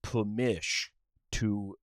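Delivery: slow, grand Southern gentleman drawl; mock Southern drawl. Answers his buddy's bit in a slow mock Southern drawl. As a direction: grant permission like a courtly Southern host.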